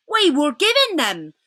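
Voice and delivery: bow and toast the queen; a hint of an anxious old fox; lightly voiced polite old fox. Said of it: "said the fox".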